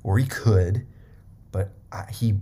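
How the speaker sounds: ordinary talk, nothing marked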